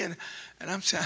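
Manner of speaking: ordinary delivery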